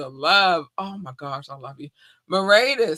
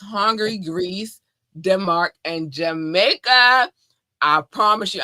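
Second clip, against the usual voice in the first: normal talking voice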